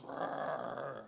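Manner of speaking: loud imitated lion roar; big rumbling mock lion's roar; lion growl. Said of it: "He's gonna growl at him".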